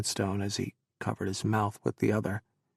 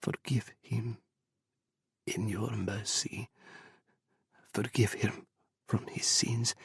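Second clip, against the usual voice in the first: softer, hushed pleading prayer; whispered prayer; 6 dB quieter than usual. Acted. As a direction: murmur it to God. Mother whispers prayer at grave.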